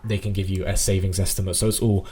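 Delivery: usual speaking voice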